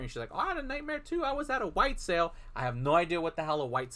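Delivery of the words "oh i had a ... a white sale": high, soft mom voice; light, high imitation of a mother; voiced mother. Mom answering her son about nightmares, high and soft.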